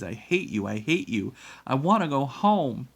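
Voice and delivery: put-on tearful child's tantrum cry; sobbing. A narrator's reading. Boy flails at the woman holding him, sobbing. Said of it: "Jamie sobbed".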